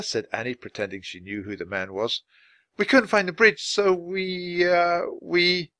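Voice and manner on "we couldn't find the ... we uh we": high voice, stalling on drawn-out words; hesitant bluff. A narrator's reading. Children pretending to know the man, stalling hesitantly. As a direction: fumble for an excuse. Annie bluffing, stuck for an excuse.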